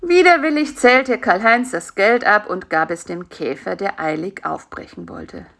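ordinary delivery

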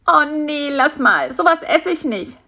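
high-voiced, disgusted refusal. Fly refuses spider's offer of grasshopper feelers, disgusted. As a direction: turn the food down with distaste. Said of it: "sagte Bisi angeekelt".

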